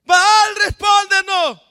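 very high, loud wailing chant; acted frantic invocation; everyone shouting. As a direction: scream it like a crowd of prophets. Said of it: "Y todos gritan".

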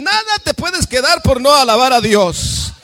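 fuerte y alto, predicando con fervor; predicación a toda voz. Real, not acted. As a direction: proclama con fuerza a la congregación. (predicando fuerte y exaltado)

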